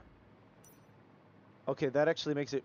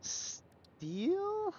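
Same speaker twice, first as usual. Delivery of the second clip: high questioning squeak. Real, not acted. Musing over block choice.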